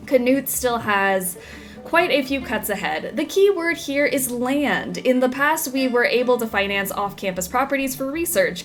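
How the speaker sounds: newspaper article read aloud; news read. Acted. Reads in-game news article.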